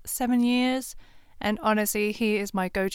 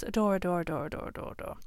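whispering; 3 semitones lower, 7 dB quieter, much faster than usual. Real, not acted. Gushing about favourite music.

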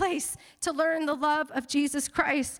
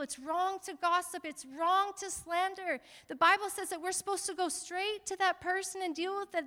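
normal talking voice

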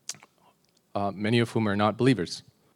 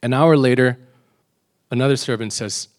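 ordinary talk

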